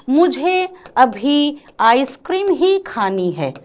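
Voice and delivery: ही stretched and leaned on, slow; stress on ही. Shown as a demonstration. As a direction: press the word ही hard. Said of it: "किस पर बल दे रहा है". ("ही" पर ज़ोर देकर)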